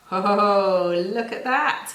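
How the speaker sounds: loud delighted gasp of discovery; oh, loud and amazed; surprised reveal. Acted. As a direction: show the class a big surprise. Reveal of the frog.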